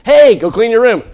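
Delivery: loud mock parent order; loud emphatic point. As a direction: hammer it out to the room. Preaching emphasis.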